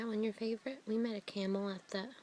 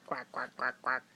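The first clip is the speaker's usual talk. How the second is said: duck quack; 10 semitones lower than usual. Shown as a demonstration. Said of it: "What's a duck say?".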